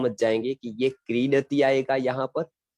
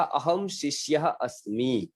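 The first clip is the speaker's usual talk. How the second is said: slow, deliberate recitation of the Sanskrit; slow Sanskrit model; slower than usual. Shown as a demonstration. Teacher slowly recites the Sanskrit sentence for the class. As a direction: read it slowly for students. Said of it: "तब मैं बोलूंगा".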